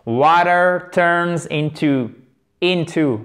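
slow, stressed Water turns into; slow model reading. Shown as a demonstration. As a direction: read it slowly for learners. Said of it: "Accent tonique sur la première syllabe". (lecture lente et articulée)

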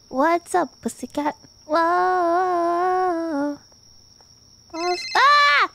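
ordinary talk, nothing marked

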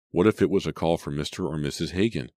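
usual speaking voice

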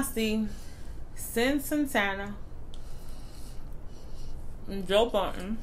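chewing while talking; talking between bites; mouth full. Real, not acted. Seen 4: Bites and chews bready food between words; mouth visibly full at times.

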